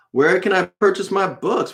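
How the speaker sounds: ordinary talk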